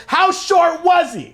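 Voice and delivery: high, loud, slow retold outburst; exasperated demand. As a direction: spell it out to them, high and loud. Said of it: "I just went".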